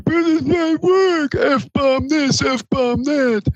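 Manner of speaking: high strained yell; freaking out. Retold or imitated. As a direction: shout it in a panic. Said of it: "David wasn't freaking out".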